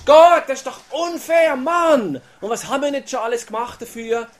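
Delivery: high, loud exasperated protest; frustrated complaint. Retold or imitated. Childless believer venting at God, high exasperated protest. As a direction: vent at God that it's unfair.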